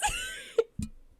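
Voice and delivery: loud high-pitched laugh; burst of laughter. Real, not acted. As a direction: crack up loudly mid-reading. Breaks up mid-reading.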